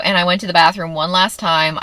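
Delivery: normal talking voice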